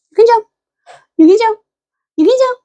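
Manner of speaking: bright high coax to a pet; encouraging pet talk. Real, not acted. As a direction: cheer the dog on to jump. Urging dog to jump repeatedly.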